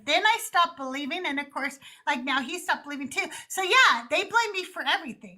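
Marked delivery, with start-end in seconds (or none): none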